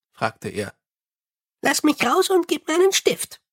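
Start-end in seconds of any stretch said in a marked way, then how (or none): 1.6-3.4 high, chirpy little imp. Acted. Imp soothes the angry Mumm, high and chirpy. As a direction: pipe it up high like a tiny helpful imp. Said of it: "sagte der Kobold".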